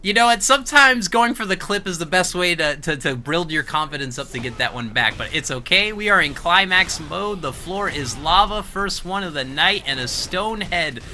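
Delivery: high, loud, hyped-up announcer; hyped commentary. Real, not acted. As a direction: push the energy to the top. Climax mode hype, high and loud.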